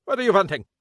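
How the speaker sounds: irate immigrant shouting at police at his door; angry German shopkeeper. Acted. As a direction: chase them off in a thick German accent. Kappelhoff, angry foreign shopkeeper.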